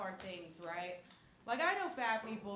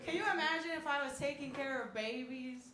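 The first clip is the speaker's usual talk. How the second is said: ordinary talk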